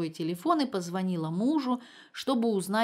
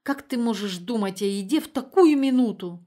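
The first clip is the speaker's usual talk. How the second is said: put-on louder, theatrical elderly mother; pompous exclamation; 3 semitones higher, 7 dB louder than usual. A narrator's reading. Mother reproaching daughter for eating, pompous exclamation. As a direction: declaim the reproach with pathos. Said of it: "пафосно воскликнула Инна Владимировна".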